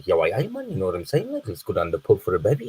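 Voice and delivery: imitated Newcastle dialect; dad's Geordie accent. Son imitating his dad off to the pub, Geordie accent. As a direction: put on a North East English accent. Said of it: "sounds like he's from the northeast".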